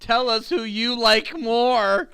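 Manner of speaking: high, loud, drawn-out call; hammed-up appeal to listeners. Host asking Cool Boy Nation to pick, high mock holler. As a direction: holler it out to the fans, stretched.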